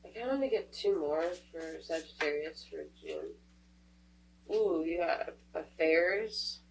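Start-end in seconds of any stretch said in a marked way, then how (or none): none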